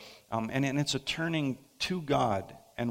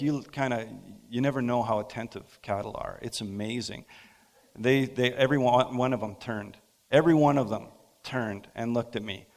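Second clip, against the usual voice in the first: ordinary talk, nothing marked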